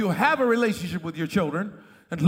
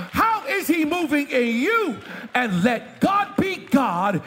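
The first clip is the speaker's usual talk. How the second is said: fiery pulpit preaching; preaching at full force; 5 semitones higher than usual. Acted. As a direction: preach it out to the congregation. Preacher climbing to a shout.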